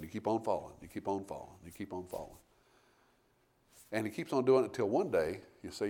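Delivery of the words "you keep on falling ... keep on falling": fading repetition. Acted. Preacher repeats, voice fading away.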